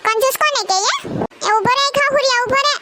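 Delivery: angry shout. Acted. Sonal aunt yelling at the boy who called her stingy, furious shout. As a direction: scream after him to stop right there.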